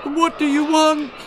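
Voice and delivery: high, loud terrified question; frightened boy. A narrator's reading. Boy asking the trolls what they want, terrified. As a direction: plead in terror.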